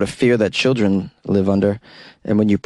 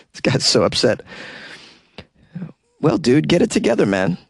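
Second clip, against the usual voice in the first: usual speaking voice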